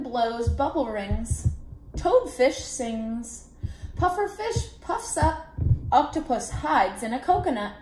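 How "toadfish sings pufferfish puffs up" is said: plain reading voice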